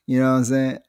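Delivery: normal talking voice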